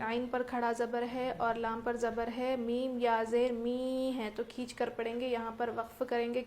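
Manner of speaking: ordinary talk, nothing marked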